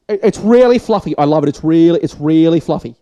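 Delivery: high, loud gushing praise; mock judge gushing. Vlogger imitating a TV judge, mock gushing. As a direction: gush over the pie like a TV judge. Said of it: "George Calombaris dude will be like".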